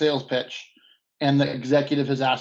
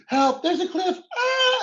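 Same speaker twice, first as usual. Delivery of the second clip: high, shrill terrified cry; terrified scream; slower than usual. Acted. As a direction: scream as the car goes over. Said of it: "your family's screaming".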